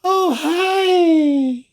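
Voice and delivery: very high, loud squeal of a hello; high-pitched greeting. A narrator's reading. Feline greets visitors from behind, high-pitched. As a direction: sing it out high and drawn out. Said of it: "The high-pitched voice comes from behind".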